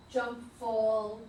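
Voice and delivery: normal talking voice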